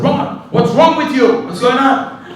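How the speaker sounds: high, slow, alarmed plea; frantic question. Retold or imitated. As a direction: demand answers in a panic. Asking Ron about the snake.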